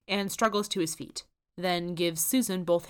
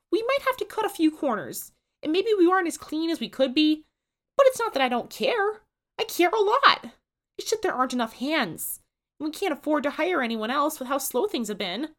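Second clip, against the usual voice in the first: higher, louder earnest plea; Susan pleading; 11 semitones higher, 7 dB louder than usual. A narrator's reading. Susan defends her diner to Ford and Dipper, high earnest plea. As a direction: plead your case, near tears.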